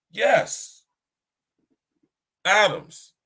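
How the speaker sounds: high, emphatic, falling exclamations; surprised confirmation. Retold or imitated. Most High confirming the answer of atoms, surprised. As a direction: imitate surprised agreement, high and punched. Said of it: "As if the Most High was surprised".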